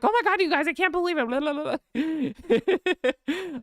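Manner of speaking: high, gushing rush of words; shot out of a cannon. Acted. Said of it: "I'm, like, shot out of a cannon".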